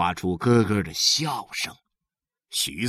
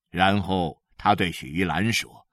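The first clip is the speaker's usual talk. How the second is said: ordinary delivery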